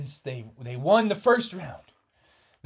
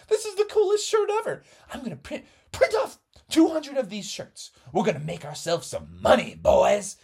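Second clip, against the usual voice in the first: greedy glee; 8 semitones higher, 4 dB louder than usual. Acted. Factory man plans to sell shirts.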